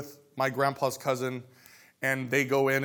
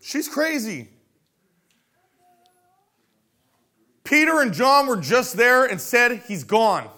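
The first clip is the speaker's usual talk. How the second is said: dismissive disciple; 8 semitones higher, 6 dB louder, slower than usual. Acted.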